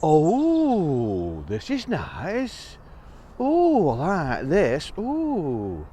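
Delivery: slow, cosy and relieved; contented sighing. Acted. Mouse settles into his nest, slow and content. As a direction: sigh it out slowly, content.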